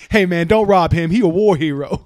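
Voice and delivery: loud, high street-guy plea. Pretend. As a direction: shout it like a neighbor. Imagined neighbor defending him.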